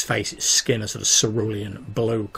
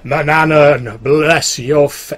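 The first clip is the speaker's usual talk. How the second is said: priestly invocation; 5 semitones higher, 12 dB louder, slower than usual. Acted. Priest invokes his god.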